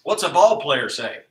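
ordinary delivery

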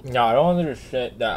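normal talking voice

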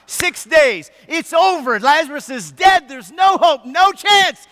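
building from firm to shouting; preacher's rising shout. Real, not acted. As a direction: swell it up to a cry. Preacher building drama of death.

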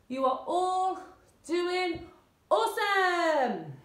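high, drawn-out cheer; cheering praise. Real, not acted. Coach telling class they are awesome, high and drawn out. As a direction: praise them big, stretching out the last word slowly.

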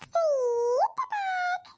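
high stretched whiny cry; childish wail. Acted. Grandson crying to granny for sweets, long high wail. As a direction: bawl it out like a toddler.

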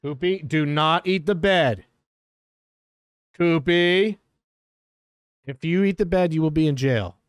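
firm mock-stern warning to a dog; scolding. Real, not acted. Owner warning her dog not to chew the bed, mock-stern. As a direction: lay down the law to the puppy.

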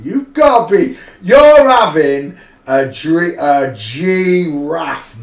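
high, loud disbelieving yell, slowing at the end; shouting you're having a giraffe; astonished shout. Real, not acted. Shocked at photo's huge shoulders.

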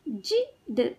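जिद with a gap before द; sound by sound. Shown as a demonstration. Teacher teaching kids to read, sounds apart. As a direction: break जिद into जि - द. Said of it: "शब्द पढ़ना सिखाऊंगी".

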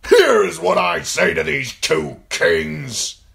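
loud, slow, scornful shout; booming contempt; spat contempt. A narrator's reading. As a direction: spit it out loudly, stretching the words. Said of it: "he spat".

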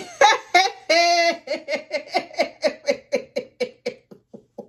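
long, high nervous laughing fit; helpless frightened cackle that slowly dies down; laughter of fear. Real, not acted. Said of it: "this is laughter of fear and nervousness".

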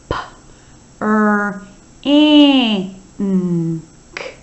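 ordinary talk, nothing marked

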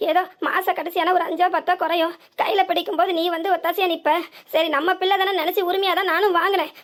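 ordinary talk, nothing marked

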